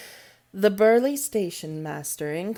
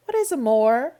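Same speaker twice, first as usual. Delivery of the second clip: put-on higher, louder, sudden child's question; 5 semitones higher, 5 dB louder than usual. A narrator's reading. Mary suddenly asking Medlock, curious child. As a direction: blurt it out curiously.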